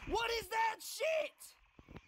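high, drawn-out outraged yell; enraged shriek. Real, not acted. Gamer protesting to the game, enraged shriek. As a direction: stretch the question into a high scream.

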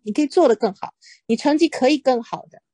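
ordinary talk

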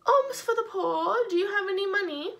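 paralyzed beggar. Acted. Paralyzed man begging passersby for money, high pleading voice. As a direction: beg like a poor man on the street. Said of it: "the paralyzed man says".

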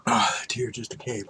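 ordinary delivery

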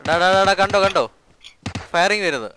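loud, high urgent alert; excited shout. Real, not acted. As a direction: shout the warning to your team. Spotting enemy gunfire. (ആവേശത്തോടെ വിളിച്ചുപറയുന്നു)

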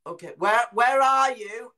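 loud, high, drawn-out call; worried calling out. Retold or imitated. Bloke searching for unseen child, imitated loud worried call. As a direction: shout it out as if searching for someone.

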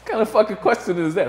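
fast, laughing disbelief. Real, not acted. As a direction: crack up while asking. Laughing at the absurd question.

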